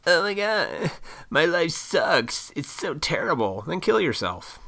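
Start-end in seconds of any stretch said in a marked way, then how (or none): 0.1-3.6 high, drawn-out mock moan; mock whining. Imitating people who moan about life, mock whining. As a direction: whine like a hammed-up complainer. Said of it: "annoys the shit out of me".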